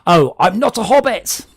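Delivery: ordinary talk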